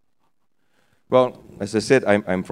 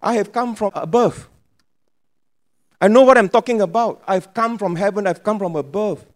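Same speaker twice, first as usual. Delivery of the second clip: high, lively voice of Jesus reassuring; preacher voicing Jesus to the congregation, high and eager; Jesus speaking; 8 semitones higher, 4 dB louder than usual. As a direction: play Jesus telling them to trust him. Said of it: "He says".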